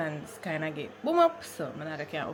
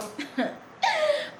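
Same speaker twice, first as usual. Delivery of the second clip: high squealing laugh; 4 dB louder than usual. Real, not acted. Excited about hair.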